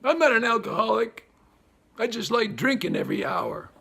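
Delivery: hammed-up shock at how hard life is; shocked wail. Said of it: "they come here like".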